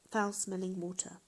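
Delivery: ordinary delivery, nothing marked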